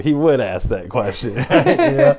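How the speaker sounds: breaking into high laughter mid-sentence; laughing. Real, not acted. Telling a friend the story, laughing hard. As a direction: laugh through it, pitch jumping way up.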